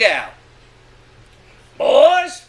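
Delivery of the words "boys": raised call to the crew; old man calling. Retold or imitated. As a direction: call them in, a touch loud. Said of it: "the old man said".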